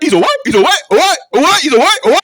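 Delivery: loud, high entertainer's holler; hammed-up high repeated shout; shouted catchphrase. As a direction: belt it out like a TV host's catchphrase.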